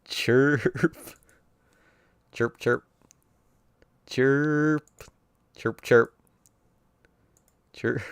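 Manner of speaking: high, thin chirping cicada noises; mimicked cicada chirps. Narrator imitating the droning cicadas, high chirps. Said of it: "The voice of cicadas".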